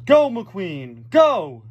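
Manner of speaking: high, loud urging shout; hammed-up crowd yell; yelled cheer. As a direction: cheer a racer on at full voice. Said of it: "they yelled".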